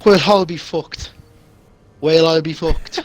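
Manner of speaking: usual speaking voice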